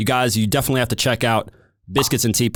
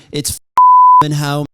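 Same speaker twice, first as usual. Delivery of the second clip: loud, dragged-out slow fan bellow; a hammed-up stadium roar; mock fan yell; 3 semitones higher, 9 dB louder, much slower than usual. Mock England fan shout.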